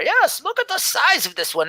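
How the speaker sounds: put-on high, awed old-lady voice; admiring old elf scribe. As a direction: marvel at the big warrior like a sweet old lady. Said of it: "Oh, oh".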